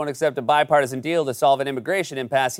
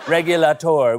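Regulator with nasal French vowels, stress on last syllable; French accent; 3 dB louder than usual. Pretend. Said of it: "French for regulator".

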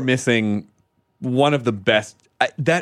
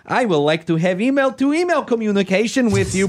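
lightly put-on Eastern European accent, higher; mock foreign accent; 5 semitones higher than usual. Podcast host reads a spam love letter in mock Russian accent. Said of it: "I'll read it in the accent I assume it was written in".

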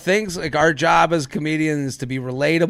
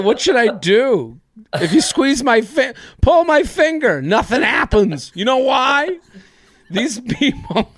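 mock heckled comic; 8 semitones higher, 4 dB louder than usual. Mock comedian voice bit.

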